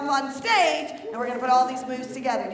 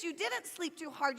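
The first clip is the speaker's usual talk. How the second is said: ordinary talk, nothing marked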